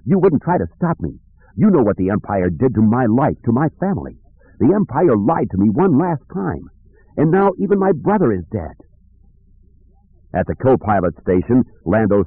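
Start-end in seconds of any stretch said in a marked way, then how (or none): 0.0-8.7 high-pitched, hurt accusation; raised pitch, wounded and defiant; aggrieved young man. Acted. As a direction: play a bitter youth pleading his grievance. Aggrieved Kip accuses Han.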